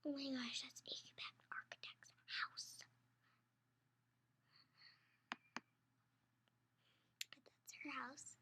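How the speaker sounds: hushed whisper pointing at the page; breathy-free soft whisper aside. Real, not acted. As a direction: whisper it to the little listener. Whispered aside about picture.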